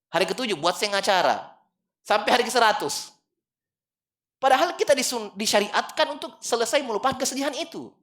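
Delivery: high and loud, urging the crowd; impassioned preaching. Acted. Preacher telling mourners to bury fast and move on, loud and high. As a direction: press the point hard from the pulpit. Preacher urges quick burial.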